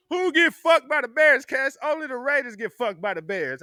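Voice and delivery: high loud protesting retort; a Raiders fan denying the loss, loud and high; mock indignant. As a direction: fire back defensively. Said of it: "I'm like".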